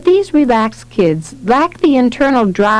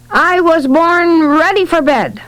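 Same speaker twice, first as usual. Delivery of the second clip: higher-pitched voiced quote; phlegmatic quip; 8 semitones higher than usual. A narrator's reading. Child gives a classic phlegmatic response, higher-pitched quip. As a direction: deliver it as a drawn, higher-pitched character line.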